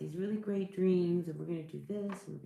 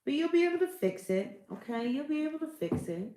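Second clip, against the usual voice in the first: bright reassurance; 9 semitones higher, 3 dB louder than usual. Real, not acted. Reassuring Leo reader.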